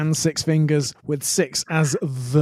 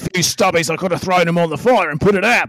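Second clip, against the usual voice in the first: hammed-up Aussie yarn; comic Australian local; Australian accent; 4 dB louder than usual. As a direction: tell it in a broad put-on Australian accent. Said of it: "does the best Australian accent".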